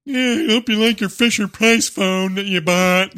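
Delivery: high, loud, slow mocking jab; mocking sneer. Mocking an online troll over a phone. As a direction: sneer at someone's cheap phone. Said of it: "pipe up and go".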